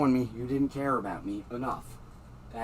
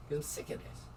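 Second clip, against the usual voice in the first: muttered under breath; 11 dB quieter than usual. Real, not acted. Fed-up muttering.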